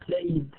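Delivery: "played" ending in a voiced D; final D sound. Shown as a demonstration.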